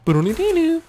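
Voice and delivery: very high sung "doodle-dee" flourish; achievement jingle. Pretend. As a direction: trill a little victory tune, very high.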